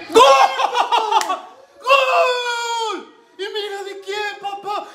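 very high, loud ecstatic scream; goal celebration scream. Real, not acted. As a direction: scream GOL with pure joy. Fan celebrating his team's goal.

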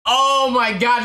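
normal talking voice

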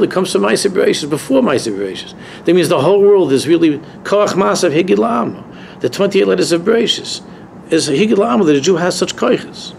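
usual speaking voice

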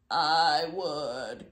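put-on a creaking, crusty old voice; creaky coral voice. A narrator's reading. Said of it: "creaked a crusty coral".

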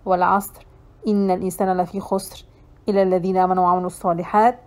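very fast, low, soft rote recitation; closing prayer. Real, not acted. Teacher closes the lesson with prayer, rapid hushed recital.

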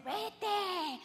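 rising insistent inner command; inner voice urging. Retold or imitated. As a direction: let the blood's urge push out. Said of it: "en mi sangre me está diciendo".

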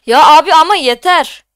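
loud, fed-up plea; exasperated protest. Real, not acted. Streamer telling viewers to stop it, exasperated. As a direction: burst out that it's enough.

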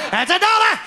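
high, loud cheeky demand; mock freak voice. Fairgoer with a hump demanding a dollar, high mock voice. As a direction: squeak out the price like a carny freak.

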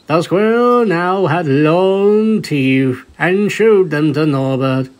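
ordinary delivery, nothing marked

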